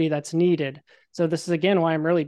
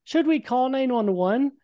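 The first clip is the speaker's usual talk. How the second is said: bystander's question; 7 semitones higher than usual. Retold or imitated. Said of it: "I hear someone say".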